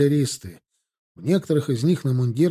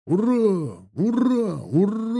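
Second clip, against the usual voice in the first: high-pitched, drawn-out hurrah; long ringing soldiers' cheer; roaring cheer; 7 semitones higher, slower than usual. A narrator's reading. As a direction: cheer like massed ranks. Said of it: "ревели шеренги".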